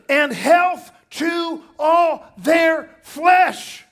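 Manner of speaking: high, loud and slow; preaching shout. Acted. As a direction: proclaim it, drawn out and loud. Scripture quoted with emphasis.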